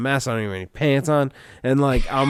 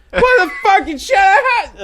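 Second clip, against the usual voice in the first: much higher, louder frantic screech; mock panicked scream; 6 dB louder than usual. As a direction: shriek like the man panicking on the intercom. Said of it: "you hear him screaming".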